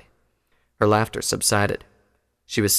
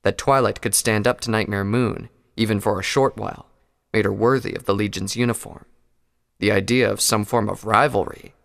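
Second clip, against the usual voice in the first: ordinary delivery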